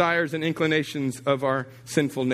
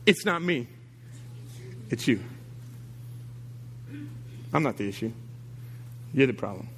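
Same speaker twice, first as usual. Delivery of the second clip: voicing God; 4 semitones lower, 7 dB quieter than usual. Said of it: "this is what he would say".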